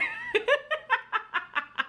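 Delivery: high giggling fit trailing on; real laugh. Real, not acted. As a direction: keep cracking up in a high pitch. Laughs at own retelling.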